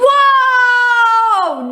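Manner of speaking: high, loud alarmed whoa; high startled yell; panicked shout. Acted. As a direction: yelp to stop mom from opening it. Mom about to open drawer.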